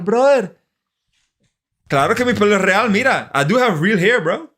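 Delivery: high, loud indignant protest; defensive and amused; emphatic insistence. Real, not acted. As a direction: laugh it off while insisting. Insists his hair is real.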